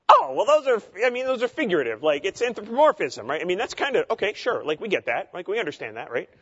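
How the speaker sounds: high-pitched imitation of people waving it off; mock breezy dismissal. Voicing dismissive people in debates.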